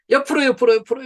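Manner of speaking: loud, high stretching call. Shown as a demonstration. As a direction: call the count out loud to the room. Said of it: "stretching instructions".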